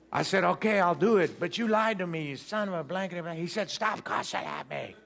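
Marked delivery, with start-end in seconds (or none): 0.4-3.2 high-pitched grudging outburst; retold angry yielding, pitched up; mock furious, give in while insulting; indignant cussing. Acted quarrel with the preacher. 3.7-4.9 slow, drawn-out mock rebuke; slow, higher-pitched scolding; scolding preacher.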